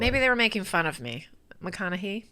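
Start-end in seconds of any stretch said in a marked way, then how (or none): 1.6-2.2 McConaughey ending in "hee", softly; testing pronunciation. Shown as a demonstration. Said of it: "Am I saying this wrong?".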